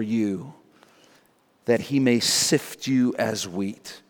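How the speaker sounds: soft, measured, solemn quote; grave warning. Retold or imitated. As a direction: warn gently, with pauses between phrases. Jesus's warning voiced gravely.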